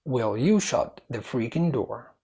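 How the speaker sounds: measured, slow answer reading; slow, clear model. Shown as a demonstration. Teacher revealing answer number two, slow and clear. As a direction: spell out the question slowly, word by word.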